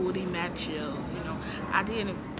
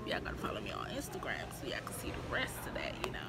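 whispering; 10 semitones lower, 11 dB quieter, faster than usual. Real, not acted. Whispered plug after a laugh.

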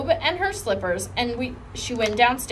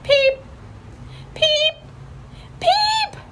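very high peeps climbing higher; each peep higher than the last; building peeps; much slower than usual. A narrator's reading. Lucille peeping out of control, climbing ever higher. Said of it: "peeping louder and louder and louder".

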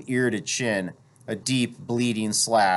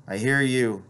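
ordinary delivery, nothing marked

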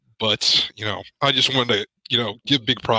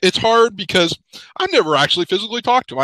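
real choked-up voice, higher and louder; pushing through tears, higher and louder; emotional voice straining higher as it breaks; 8 semitones higher, 5 dB louder than usual. Real, not acted. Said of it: "I hadn't gotten choked up until now".

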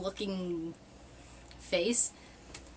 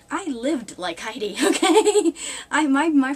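laughing through the words, much higher and louder at the end; 6 semitones higher, 9 dB louder, slower than usual. Real, not acted. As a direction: crack up as you admit it. Laughing at own life.

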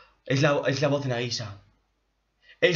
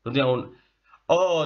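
ordinary delivery

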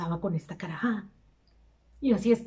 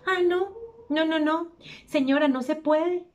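higher-pitched mimicked refusal; put-on higher voice of a clerk; young store clerk; 6 semitones higher than usual. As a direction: imitate the shop boy turning her down. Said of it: "se me ocurre preguntarle al muchacho".

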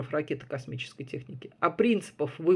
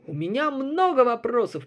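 raised orator voice; loud soapbox ranting; 7 semitones higher, 5 dB louder than usual. A narrator's reading. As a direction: address the crowd, loud and higher. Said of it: "продолжал Фрейд". (громкая речь оратора)

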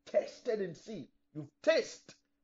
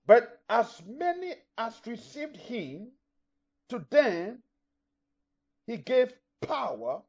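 scripture reading; 4 semitones higher, slower than usual. Acted. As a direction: proclaim each phrase of the verse slowly. Reads the scripture verse aloud.

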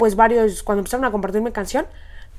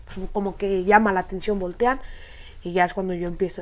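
ordinary talk, nothing marked

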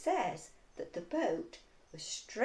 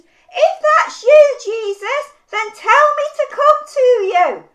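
slow, high, very loud call; shouting over a storm; 13 semitones higher, 18 dB louder, slower than usual. Acted. Peter calling to Jesus over the storm, slow full shout. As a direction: bellow each phrase across wind and waves. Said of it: "Peter shouted out".

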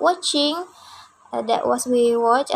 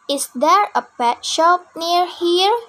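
slow, higher "Is there a pet shop near here?" with rising end; model question; 5 semitones higher, slower than usual. Shown as a demonstration. Teacher models the sentence for students to repeat, slow. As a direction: lift it as a clear question.

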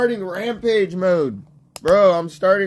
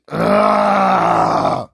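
loud drawn-out "ugh"; frustrated roar; 5 dB louder than usual. Acted. Gamer worked up over his target, loud roar. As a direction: let out a loud growl of effort.

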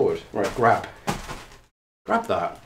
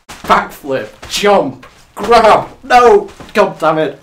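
building shouts, higher and much louder; shouted commands to frustration; 8 semitones higher, 10 dB louder, much slower than usual. Real, not acted. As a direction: shout each move, then cry out in frustration. Calling moves, then failing.

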